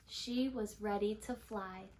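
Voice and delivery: usual speaking voice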